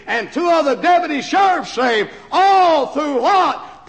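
loud, rising, very slow call; preaching shout. Real, not acted. Preacher driving home his point to the congregation, shouting. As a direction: hammer the question home. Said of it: "preaching climax".

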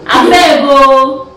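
Abeg o stretched slowly; slow, drawn Abeg o; slow drawn-out plea. Shown as a demonstration. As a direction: drag out Abeg o pleadingly. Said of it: "Please, not Apmaka".

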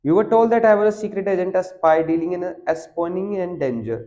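reading the English passage slowly and deliberately; slow text reading. Acted. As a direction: read each word out slowly for the class. Reads the English text aloud. (धीमी गति से पाठ पढ़ना)

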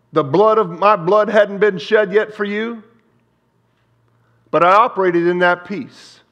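loud preaching of Jesus' words; full-force preaching. Acted. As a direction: preach it out with fire.

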